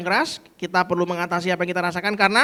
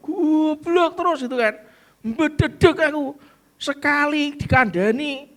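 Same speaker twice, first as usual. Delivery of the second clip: fed-up anger; 7 semitones higher than usual. Acted. A fed-up teacher ranting in raised angry voice. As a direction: vent the frustration of teaching someone who never gets it. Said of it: "terlalu marah".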